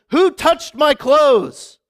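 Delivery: slow, loud and high demand; stretched-out demanding question; demanding Jesus. Retold or imitated. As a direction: call it out over the crowd, slowly. Jesus voiced demanding in retelling.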